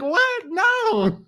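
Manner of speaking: mock surprised refusal. Women answering demands to submit, high mock disbelief. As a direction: act stunned, then shut it down. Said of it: "We're like".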